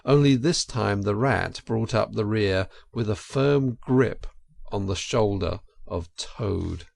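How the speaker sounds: ordinary delivery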